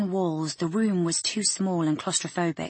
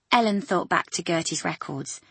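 ordinary delivery, nothing marked

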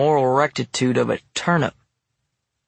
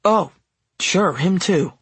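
higher, eager afterthought; quick-to-agree, raised pitch; piping right up; 7 semitones higher, 4 dB louder, slower than usual. A narrator's reading. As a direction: chime in too readily. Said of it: "I piped right up".

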